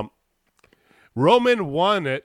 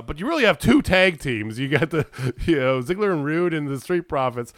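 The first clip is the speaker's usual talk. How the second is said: ordinary talk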